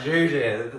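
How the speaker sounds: imitating own tipsy slurred call; drunken slur. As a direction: slur it like you were drunk. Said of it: "I was slurring a little bit".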